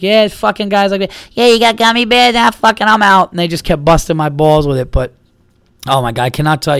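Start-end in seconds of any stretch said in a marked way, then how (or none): 0.0-3.3 high, loud mocking imitation; mocking him. Comedians bust his chops with a mocking imitation, higher and louder. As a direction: ham up his whining. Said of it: "they're like".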